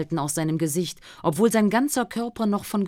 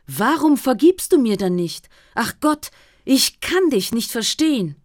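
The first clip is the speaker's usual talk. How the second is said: raised, louder voice of an indignant friend; reproachful friend; 5 semitones higher, 6 dB louder than usual. Acted. Friend berating the suffering Job, indignant and loud. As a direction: scold Job as a pious friend who can't understand him.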